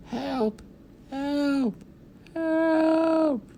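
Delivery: tiny, high distant cries for help; little man crying help from the roof beams, tiny and high; tiny far voice. A narrator's reading. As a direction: call faintly from far above, very high. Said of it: "The voice, a very tiny one".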